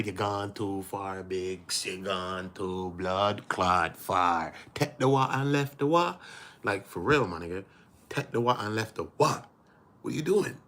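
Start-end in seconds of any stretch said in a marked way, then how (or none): 0.0-6.2 put-on slow, drawn-out Jamaican movie accent. Quoting the Shotters movie to Wack, Jamaican accent. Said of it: "The movie Shotters with the Jamaican people".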